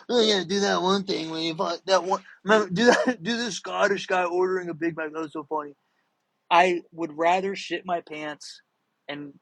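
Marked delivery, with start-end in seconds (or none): 0.1-5.8 mocking put-on voice of a pestering fan; eager requester. Imitating someone begging for a Scottish guy bit, mock-eager. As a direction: rattle it off as an excited, laughing requester. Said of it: "Anytime someone's like".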